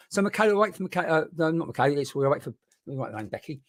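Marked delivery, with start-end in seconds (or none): none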